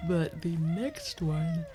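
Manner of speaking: slow, yawning through the words. Real, not acted. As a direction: say it mid-yawn, stretched out.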